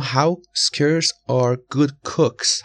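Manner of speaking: very slow, every word spaced out, scarce stressed; slow model reading. Shown as a demonstration. Teacher to Persian students, reading the example very slowly. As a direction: read it slowly for the learners, leaning on scarce. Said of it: "جملهٔ سوم".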